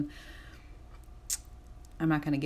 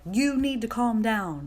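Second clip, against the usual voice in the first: slow, raised, louder bossy order; assertive, aggressive; 6 semitones higher, 5 dB louder, slower than usual. Acted. Vlogger acting her past self telling people off, aggressive. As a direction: imitate someone pushily giving orders. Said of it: "that's very assertive, that's very aggressive".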